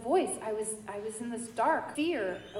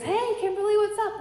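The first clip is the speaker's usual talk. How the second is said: high, bouncy hello; imitating an upbeat priest on the phone; cheery phone greeting; 9 semitones higher, 5 dB louder than usual. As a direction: answer the call brightly, pitched well up.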